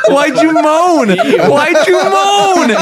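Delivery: high squealing laughter; laughing hard through the words. Real, not acted. Laughing at a friend's moan.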